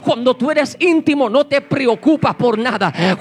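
loud, driving sermon voice with hammered refrains; full-force preaching. Acted. Preacher exhorting the congregation about intimacy with God, loud and driving. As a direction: preach it out loud to the whole church.

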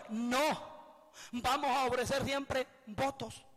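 slow, stubborn insistence, a touch of anger; mildly angry quote. Acted. Stubborn people insisting on their vows, mildly angry and slow. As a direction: drag it out stubbornly. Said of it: "todavía están enojados diciendo".

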